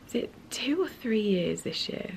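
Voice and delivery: soft whispered question; hushed as if to herself; unvoiced wondering whisper. Real, not acted. Recalling her dead cat.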